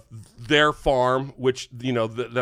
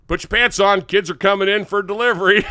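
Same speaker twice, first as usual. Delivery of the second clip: higher, louder heads-up call; retold warning; 5 semitones higher, 5 dB louder than usual. Neighbour parents warning before the egg delivery, retold call.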